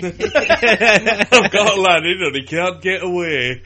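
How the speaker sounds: put-on slow laughing Yorkshire accent. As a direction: drawl it in broad Yorkshire. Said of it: "that works in a Yorkshire accent".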